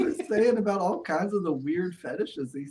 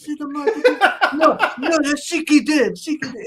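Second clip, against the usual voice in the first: Iron Sheik impression; 10 semitones higher, 7 dB louder than usual. Retold Sheik dialogue.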